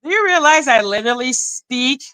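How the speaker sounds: ordinary delivery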